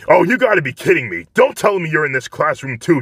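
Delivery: hot-tempered rival annoyed at the sight of Deku; irritated Bakugo. Acted. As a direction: spit it out in disbelief.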